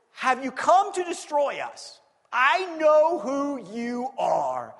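loud, slow shouting spirit; slow menacing cry; hint of a shouting demon. Demon cries out.

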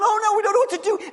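high, loud, fast mock panic; panicked rush. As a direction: blurt it in a frightened rush. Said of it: "we're like going".

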